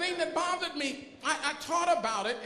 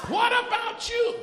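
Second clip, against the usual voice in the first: loud and pitched higher on the repeat; higher preaching challenge; 8 semitones higher, 6 dB louder than usual. Acted. Pastor pressing the church again, higher challenge. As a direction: lift the repeat up and press harder. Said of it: "repeated challenge, higher".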